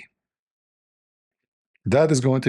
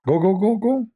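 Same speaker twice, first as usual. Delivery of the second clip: shooing; 10 semitones higher than usual. Pretend. Urging wife to leave.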